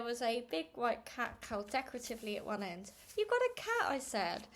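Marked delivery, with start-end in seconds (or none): 3.2-3.9 put-on high, bright exclamation of a girl spotting a cat; surprised child. A narrator's reading. Girl to aunt, surprised at finding her cat. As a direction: gasp it out as a delighted discovery.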